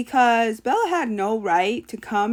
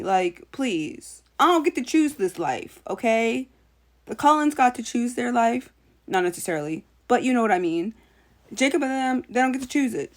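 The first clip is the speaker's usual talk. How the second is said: ordinary talk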